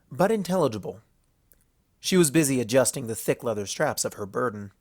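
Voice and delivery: plain reading voice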